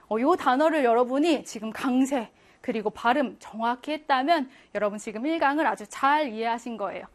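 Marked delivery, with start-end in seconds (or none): none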